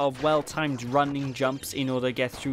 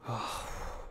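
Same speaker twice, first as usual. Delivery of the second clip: weary sigh; 4 semitones lower, 12 dB quieter than usual. Real, not acted. Dreading hardest level ahead.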